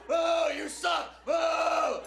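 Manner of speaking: mock crowd jeers. Comic imitating a punk crowd jeering at him, loud slow yell. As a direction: howl it like a hostile punk crowd. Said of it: "They were like".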